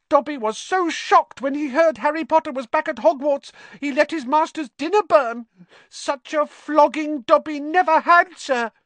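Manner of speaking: high, loud, squeaky elf voice; squeaky elf, dramatic and woeful; distressed house elf. Acted. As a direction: wail the misfortune. Elf voice held.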